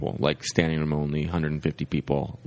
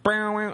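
high sung bass-line imitation; mock bass riff. Comic riffing along with the bass, singing a high mock riff. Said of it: "going, like".

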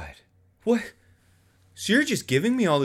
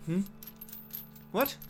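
normal talking voice

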